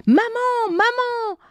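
put-on high child's voice calling mum. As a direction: call out to mum like a little boy. Léo appelle sa mère. (petit garçon qui appelle sa maman, voix aiguë)

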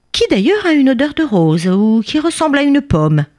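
high, small-creature voice making excuses; pleading little bee. Acted. Tiny bee begging the angry king's forgiveness, pleading.

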